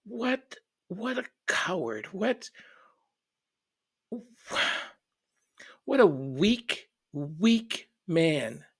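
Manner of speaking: high-pitched, incredulous contempt; sit stunned, repeating it in high, halting disbelief; retold angry disbelief. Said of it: "I just sat there and I went".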